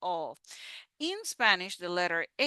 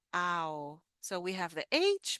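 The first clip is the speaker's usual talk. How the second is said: ordinary talk, nothing marked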